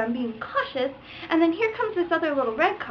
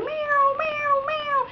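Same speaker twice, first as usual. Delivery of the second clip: high repeated "meow" whooshes; string of high-pitched zoom noises; imitated cars zooming; 12 semitones higher, much slower than usual. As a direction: mimic cars whizzing past.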